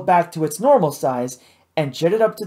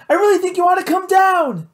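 high, raised worried call; 14 semitones higher, 4 dB louder than usual. A narrator's reading. Kate urges Matt to climb down, high worried call. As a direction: plead with him loudly to come down. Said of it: "Kate called".

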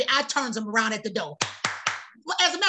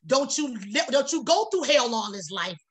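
ordinary delivery

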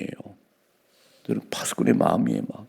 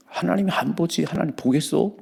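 higher, scornful taunt; mocking sinners; 8 semitones higher than usual. Preacher mimicking the wicked scoffing at God, higher pitched. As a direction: scoff with a raised voice. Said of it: "하나님이 안 본다니까".

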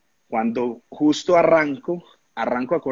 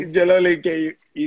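ordinary delivery

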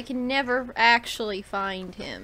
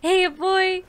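excited greeting; 6 semitones higher, 8 dB louder than usual. Real, not acted. Greeting the puppy in game.

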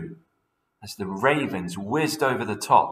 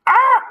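piercing high shriek of alarm; scream; 13 dB louder than usual. Acted. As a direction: shriek in panic.

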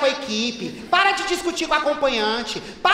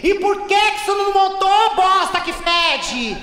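angry scolding; 3 semitones higher than usual. Acted. Boss scolding employee.

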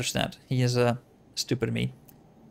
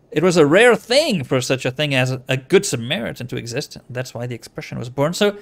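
usual speaking voice

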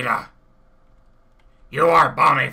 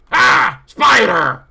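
sudden yell, higher; startled shout; 7 semitones higher, 7 dB louder, slower than usual. Acted. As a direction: jump and yell at the spider. Startled by a spider.

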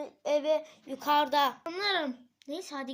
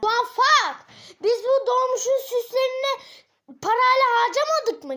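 higher, louder exasperated yell; angry outburst; 8 semitones higher, 9 dB louder than usual. Acted. Scolding the others about the money, yelling angrily. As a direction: shout at the dumb mistake.

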